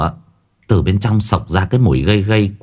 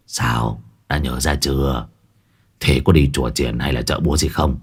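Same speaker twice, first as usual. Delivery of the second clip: much lower, softer pushy shaman; gruff urging; 8 semitones lower, 5 dB quieter than usual. A narrator's reading. Môn Phọt hurries Quyên, low and urging. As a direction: press her low and impatient. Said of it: "Môn Phọt thúc giục".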